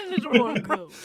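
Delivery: laughing through the words. Real, not acted. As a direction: giggle out the repeated line. Echoing a joke while laughing.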